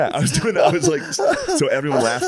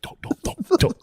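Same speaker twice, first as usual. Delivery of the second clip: urgent hushed pleading; whisper. Retold or imitated. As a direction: friend whispering to stop him.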